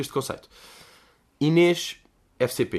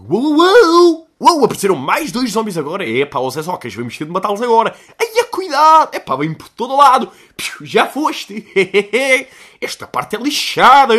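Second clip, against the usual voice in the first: shrieking, frantic gamer yelling much higher and louder; hyped gamer streamer; 10 semitones higher, 10 dB louder than usual. Retold or imitated. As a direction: play it like a kid streamer losing it over zombies. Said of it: "ele a jogar". (imitação histérica de streamer a jogar, aos gritos)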